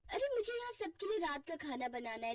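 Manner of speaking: normal talking voice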